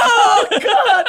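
high, loud hammed-up cry; mock horror. Princess attacked mid-fight, high mock-horrified shout. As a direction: cry out in mock agony through laughter.

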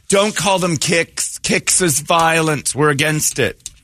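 lilting, high correction drawn out slow; mock sing-song scold. Host mockingly corrects co-host's slang, sing-song. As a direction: sing the correction like a prissy hall monitor.

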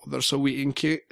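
usual speaking voice

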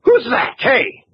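sudden alarmed question; startled cry. Acted. Mills hears Kay behind him, startled. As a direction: caught off guard.

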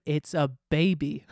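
ordinary talk, nothing marked

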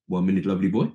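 lightly voiced Dutch manager, brushing him off; breezy manager putting the young player off; imitating Ruud Gullit.

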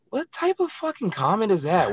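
high, slow, disbelieving outburst; incredulous. Real, not acted. Fighter scoffing at a rival's threat, high and slow. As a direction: ask it like you can't believe it.

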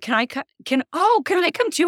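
hammed-up please-include-me voice; imitating pleading friends. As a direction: whine it like friends begging to tag along. Friends begging to tag along.

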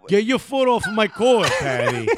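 high, rowdy Staten Island yell; mock Staten Island shove-off; Staten Island accent. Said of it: "Staten Island".

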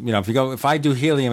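normal talking voice

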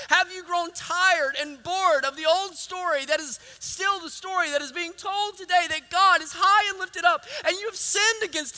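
high, mounting pulpit challenge; full-force preaching. Real, not acted. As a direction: drive each clause at the congregation.

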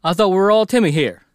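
playing a confused Southern restaurant hand; Southern accent. Ted protesting to his brother Timothy, loud Southern accent. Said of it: "why we're doing Southern accents".

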